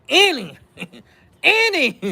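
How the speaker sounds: laughing helplessly through it; can barely get the word out for laughing. Real, not acted.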